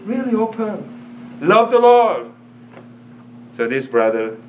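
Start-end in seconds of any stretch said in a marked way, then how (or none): 0.1-2.3 excited exclamation. Retold or imitated. Boy opening up, exclaimed.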